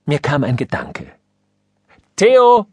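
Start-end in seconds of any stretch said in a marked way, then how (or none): 2.2-2.7 loud, high call of the name. A narrator's reading. Father calling his son to come to him, loud. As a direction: call the boy across the house. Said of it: "rief ich".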